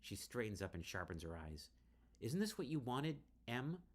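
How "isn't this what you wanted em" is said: perfectly clear. Acted. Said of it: "she says perfectly clear".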